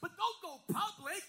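loud, driving pulpit shout; full-force preaching. Acted. Pastor exhorting his church to stand firm, preaching at full force. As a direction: rouse the congregation, lifting pitch and volume toward the close.

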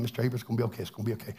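soft, soothing reassurance to a patient; soothing nurse. Retold or imitated. Nurse soothing a freezing chemo patient, soft and reassuring. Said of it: "she kept talking to me".

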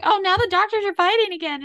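high-pitched imitation of an older lady; older lady's complaint. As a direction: mimic an exasperated old lady up high. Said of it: "just the way she said it, coming from, like, the older lady".

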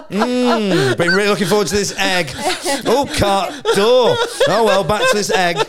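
high, laughing mock-dreamy voice; laughing imitation of the egg-loving housemate; mock creepy housemate. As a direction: savour the egg like a contented weirdo. Voicing the candlelit egg-frying housemate.

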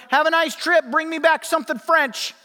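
put-on high, bright kid calling after the van; kid waving goodbye. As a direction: call out cheerfully like a little kid waving. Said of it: "he's waving at him going".

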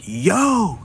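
high-pitched "yo" called out; yelled call. Retold or imitated. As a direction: call out to get someone's attention. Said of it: "You know, I'll yell that".

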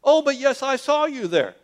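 high, loud contradiction; insistent accusation. Acted. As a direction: insist loudly that you are certain. Voices accuser insisting against Peter.

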